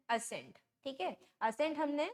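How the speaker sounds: usual speaking voice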